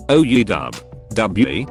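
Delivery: usual speaking voice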